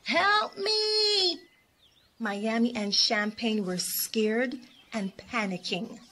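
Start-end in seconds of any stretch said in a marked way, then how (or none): 0.1-1.4 very high, drawn-out cry for help; screamed plea. Acted. June snatched by a hummingbird, screaming. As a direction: scream for rescue at high pitch. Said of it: "June screamed".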